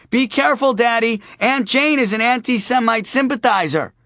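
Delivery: put-on high, bright little girl piping up; a young daughter chiming in. Daughter butting in on the phone call, little girl voice.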